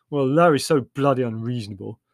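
loud, cross complaint; a touch of sibling exasperation; exasperated. Acted. Acted quarrel continues.

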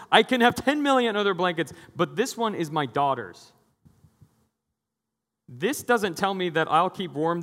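high, loud fervent declaration; impassioned preaching. Retold or imitated. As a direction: preach it full force about love. Pastor's impassioned speech retold.

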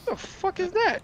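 high, incredulous squeal of a question; retold double take. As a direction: act baffled, pitch shooting way up. Retells his double take at subwoofer.

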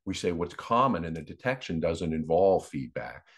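usual speaking voice

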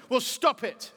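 ordinary talk

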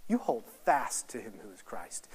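ordinary delivery

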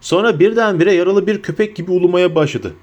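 ordinary delivery, nothing marked

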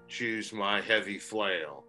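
ordinary delivery

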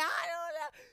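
high, loud protest to mom; panicked yelling back. Retold or imitated. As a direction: wail it back defensively. Acts out answering screaming mom.

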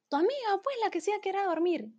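high, quick motherly prompting; insistent mother. Retold or imitated. Mother asks daughter about missing friend, high insistent imitation.

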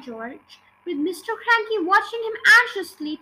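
plain reading voice

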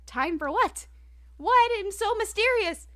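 high, loud, incredulous outburst; exasperated curiosity. Acted. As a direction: wail it in mock frustration. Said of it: "And it's like".

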